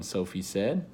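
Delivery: usual speaking voice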